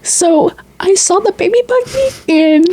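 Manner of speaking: high-pitched, hammed-up soap opera storyteller; mock soap melodrama. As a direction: overplay it like an emotional daytime drama. Mock soap opera retelling, dramatic music.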